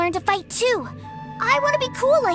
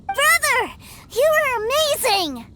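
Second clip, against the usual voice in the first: high, thrilled cheer; excited little brother; 7 semitones higher than usual. Acted. Little brother cheering his big brother, high and excited. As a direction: burst out in admiration.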